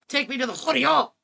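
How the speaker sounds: loud, gleeful demand; excited loud exclamation; eager shout. Real, not acted. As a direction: throw yourself into the fun, loudly. Picks the cousins, eagerly.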